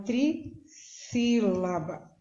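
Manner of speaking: "trissílaba" slow, with a break after "tri"; syllable by syllable. Shown as a demonstration. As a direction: draw out "trissílaba" in separate parts. Teacher splitting the term into syllables.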